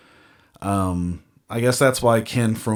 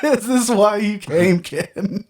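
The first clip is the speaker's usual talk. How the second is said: laughing; 10 semitones higher than usual. Real, not acted. Teasing co-host with laughter.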